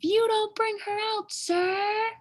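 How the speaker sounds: high, fast barking yaps; a girl speaking her best dog to a dragon; dog language. Acted. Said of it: "in the best dog language".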